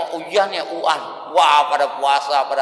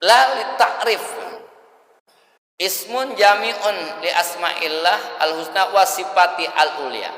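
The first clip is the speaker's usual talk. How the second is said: Arabic recitation; slower than usual. Acted.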